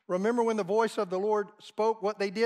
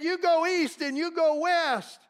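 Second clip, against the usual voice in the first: defiant rebuke; 8 semitones higher, 4 dB louder than usual. Retold or imitated. Woman rebuking Satan's voice in her head, a bold higher louder command. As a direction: order the devil off firmly. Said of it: "and she said".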